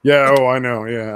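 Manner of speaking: ordinary talk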